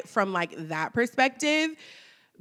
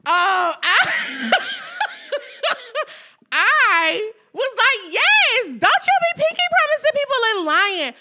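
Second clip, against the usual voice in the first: laughing; 12 semitones higher, 6 dB louder than usual. Retold or imitated. Laughing scolding quote.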